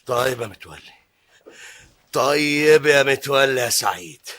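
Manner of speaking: low, slow threatening grudge; menacing vow of revenge. Acted. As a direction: promise revenge under the breath. Vowing revenge alone.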